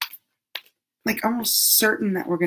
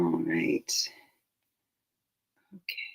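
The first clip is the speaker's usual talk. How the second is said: whisper; 13 semitones lower, 7 dB quieter, slower than usual. Real, not acted. Whispered to herself.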